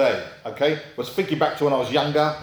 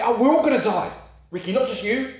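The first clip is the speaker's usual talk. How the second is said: bubbly presenter; 9 semitones higher than usual. Retold or imitated. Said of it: "they say".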